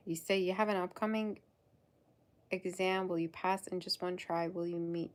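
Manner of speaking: ordinary delivery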